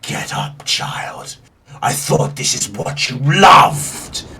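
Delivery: slow, breathy whisper from the sky; storm deity. Acted. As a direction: taunt in a slow, hushed, otherworldly voice. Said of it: "you hear the words".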